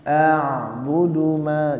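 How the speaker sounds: drawn-out a'budu ma; slow Quranic recitation. Shown as a demonstration. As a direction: chant the ain in a'budu slowly. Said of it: "jangan terhenti ain". (bacaan Al-Qur'an pelan)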